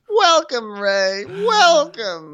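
very high falsetto greeting; mock gushing welcome. Host welcoming Ray, mock ecstatic. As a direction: hail him with hammed-up delight.

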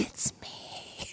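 faint, drawn-out whisper; voice of chamomile tea. Acted. The tea calling out to a listener, ghostly whisper. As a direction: whisper it like a far-off spirit calling. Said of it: "Is this the call of the wild?".

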